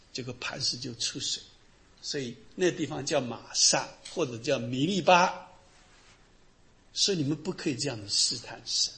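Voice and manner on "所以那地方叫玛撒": normal talking voice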